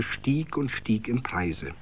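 usual speaking voice

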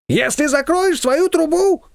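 high, slow, drawn-out haggling; bargaining Shushana. A narrator's reading. Neighbour haggling with the confectioner, high and slow. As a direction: hold out the deal, pitched high. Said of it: "немедленно начала торговаться".

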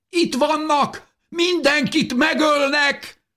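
very high, slow panicked cry; boy's shout. A narrator's reading. Boy warns everyone the killers are here, crying out. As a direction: raise the alarm in a high boy's voice. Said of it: "kiáltotta a fiú".

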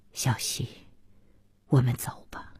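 soft, low-pitched murmur; gentle murmur. A narrator's reading. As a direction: speak low and softly to someone you hold. Husband gently embracing his silent wife.

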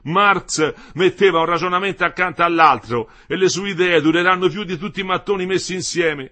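loud, high, emphatic husband; Metello, impassioned defence. A narrator's reading. Husband defends Marx's ideas to his wife, impassioned and loud. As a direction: proclaim it with full conviction.

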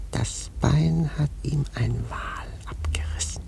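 urgent secret whisper; conspiratorial whisper. Acted. Prophet whispering the captain's dark past to the sailors. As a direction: whisper the dark tales close.

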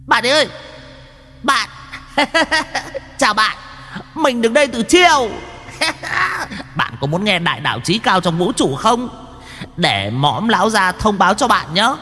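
squeaky high imp voice; shrill demon voice. Acted. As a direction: screech it like a show-off imp. Chattering demon voice.

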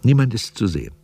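ordinary talk

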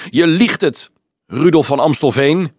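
cried out loudly in challenge; calling out. Acted. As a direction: call out the accusation like a defiant boy. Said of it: "riep een grote jongen".

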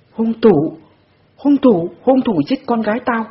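high, loud frantic scream; frenzied scream. A narrator's reading. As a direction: scream like she's lost her mind. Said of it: "bèn thét lên như phát cuồng". (thét lên như phát cuồng)

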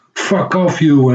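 hoge, langgerekte scheldkreet, nagespeeld; scheldend. Retold or imitated. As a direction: imiteer je eigen uitval tegen een beller. (nagespeeld schelden, hoog en traag)

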